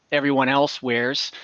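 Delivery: ordinary talk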